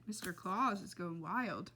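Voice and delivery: usual speaking voice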